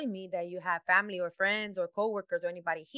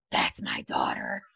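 whispered realization; slower than usual. Retold or imitated. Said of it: "I clearly remember I said".

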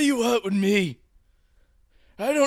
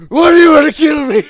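screaming; 5 semitones higher, 14 dB louder, faster than usual. Acted. Captive screaming at kidnapper.

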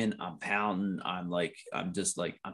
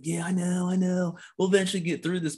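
higher-pitched mock agreement; put-on agreeable voice, pitched up; playing along; 9 semitones higher than usual. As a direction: go along with the comforting, not meaning it. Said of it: "I'm playing along with it going like".

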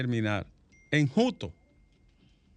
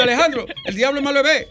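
fast, high, loud words through laughter; can barely get it out for laughing; the host laughs as he quotes the caller; laughing while talking; 11 semitones higher, 9 dB louder, faster than usual. Real, not acted. Retelling the call, laughing.

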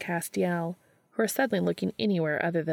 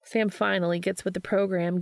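plain reading voice

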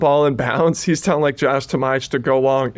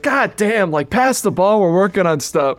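exasperated coach yell; 7 semitones higher than usual. Retold or imitated. Coach yelling at walk-ons in practice, exasperated mock yell. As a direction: bark it like a fed-up coach, half-joking.

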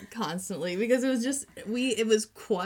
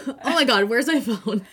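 mock panic; 3 semitones higher, 9 dB louder than usual. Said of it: "all of a sudden she goes".

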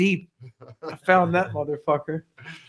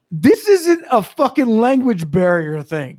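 loud, slow, raised exasperated yell; retold yelling; 4 semitones higher, 5 dB louder, slower than usual. Foreman retelling how he told off a driver, exasperated yelling. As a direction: spell it out loudly for a clueless driver. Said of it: "and I was yelling".

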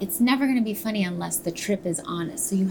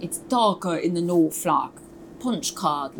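Northern English accent. Shown as a demonstration. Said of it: "Missus Lovett is from the South".

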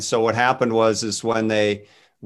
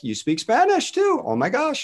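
much higher, squeaky mock surprise; amazed that God speaks Spanish; mock astonishment; 13 semitones higher than usual. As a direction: play up a stunned disbelief. Mock shock at God's Spanish.

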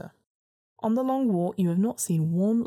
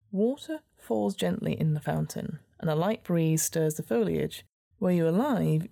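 ordinary delivery

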